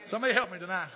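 normal talking voice

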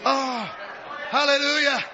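ordinary delivery

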